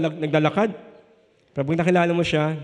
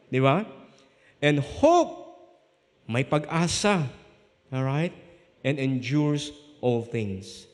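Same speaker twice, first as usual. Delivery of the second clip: normal talking voice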